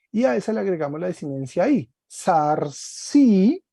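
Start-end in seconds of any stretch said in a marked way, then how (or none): 2.0-3.6 "Sarsi" stressed on a long, rising final i; "Sarsi" slowly, end syllable lifted; acute accent. Shown as a demonstration. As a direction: hit and hold the "si" of "Sarsi". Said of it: "sarsi tiene acento agudo".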